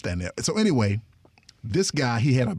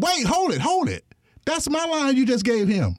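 high, heated objection; retelling his indignant cry at the director, pitched high; indignant protest; 12 semitones higher than usual. Retold or imitated. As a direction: protest like your line was stolen. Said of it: "So I'm getting mad".

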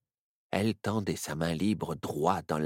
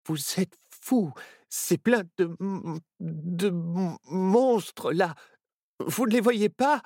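higher, slow and stammering with fright; trembling fear; 11 semitones higher, 3 dB louder, slower than usual. A narrator's reading. Nao, trembling, warns his friends about monsters: higher, slow stammer. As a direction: stutter the word monstres in terror. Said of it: "tout tremblant".

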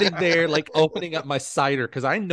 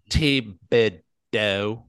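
Thibodeau drawn out very slowly, syllable by syllable; mock-slow Thibodeau with gaps between syllables; broken into syllables; 5 semitones lower, much slower than usual. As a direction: break Thibodeau into three long, separate beats. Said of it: "break it out as Thibodeau".

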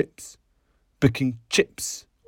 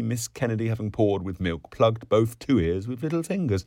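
usual speaking voice

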